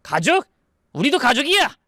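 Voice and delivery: high, offended outcry; indignant retort. Acted. Uncle insisting he is family too, indignant. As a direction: throw it back with pitch leaping.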